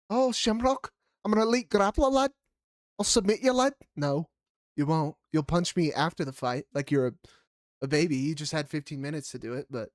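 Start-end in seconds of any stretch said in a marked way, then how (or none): 0.1-3.7 high, hammed-up Irish brag; mocking the fighter's trash talk in a hammed-up Irish accent; mock Irish boast. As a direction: puff up like a cocky Irish grappler.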